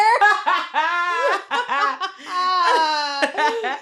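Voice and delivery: high loud burst of laughter. Real, not acted. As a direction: crack up out loud. Big laugh after joke.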